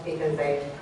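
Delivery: usual speaking voice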